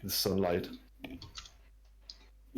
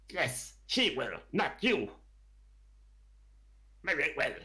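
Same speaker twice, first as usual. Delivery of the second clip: kobold retort; 6 semitones higher than usual. Acted. Kobolds bicker over tribute.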